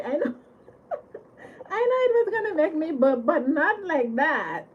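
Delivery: real laughter through the words; high, breaking with laughter; laughing. Real, not acted. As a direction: talk while cracking up. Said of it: "I cannot stop laughing".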